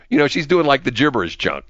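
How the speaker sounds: ordinary talk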